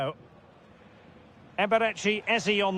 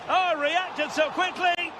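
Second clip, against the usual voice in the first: high, drawn-out exclamation; excited reaction; 7 semitones higher, slower than usual. Real, not acted. Commentator reacting to a quick reaction, excited. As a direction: react to the chance, pitched up and slow.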